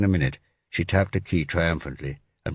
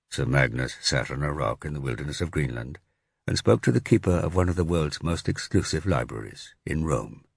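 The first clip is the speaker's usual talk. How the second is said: ordinary delivery, nothing marked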